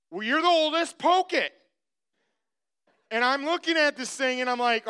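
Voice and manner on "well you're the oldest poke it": put-on higher, louder kid's demand; lightly acted pushy brother; mock insistent brother. Brothers push him to poke it.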